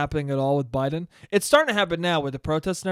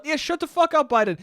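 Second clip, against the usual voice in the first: higher, dismissive mock crowd; 9 semitones higher, 3 dB louder than usual. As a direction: brush him off sharply. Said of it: "crowd shuts Biden down".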